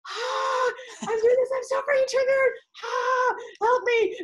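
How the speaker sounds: high, loud, hammed-up cry for help; mock panicked plea. Coach imitating herself calling her coaches for help, hammed-up high wail. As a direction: wail it to your friends like you're overwhelmed. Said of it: "and I'm like".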